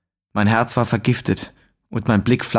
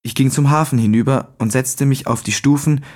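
ordinary delivery

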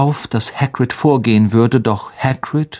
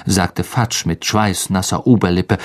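ordinary delivery, nothing marked

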